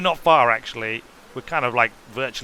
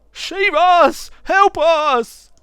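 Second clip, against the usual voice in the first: loud, much higher panicked shouts; terrified cries; 13 semitones higher, 9 dB louder, much slower than usual. A narrator's reading. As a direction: scream for help from the docks. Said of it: "terrified people shout".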